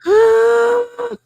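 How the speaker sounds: loud, high mock-panic gasp; panicked gasp. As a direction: gasp in exaggerated alarm.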